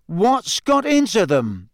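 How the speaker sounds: high, drawn-out baffled demand; Ron, demanding. A narrator's reading. Ron to Harry about the girls, baffled demand. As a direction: demand it in bewilderment. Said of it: "he demanded".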